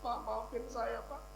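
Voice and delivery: hushed, mock-weepy begging; imitated tearful apology. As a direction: act out apologising through tears. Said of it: "Makan sambil nangis".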